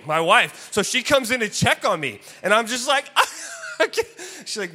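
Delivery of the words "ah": high, helpless laughing wail; imitated wailing laugh. Wife checks on laughing husband, acted high wail. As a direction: act out laughing past control. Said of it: "like, wailing".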